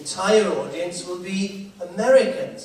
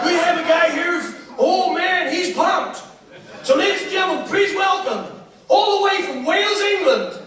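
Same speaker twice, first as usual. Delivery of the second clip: high, loud hype-man introduction; cruise director announcing; 12 semitones higher, 5 dB louder than usual. Retold or imitated. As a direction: belt out the act's intro to the crowd. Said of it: "the cruise director".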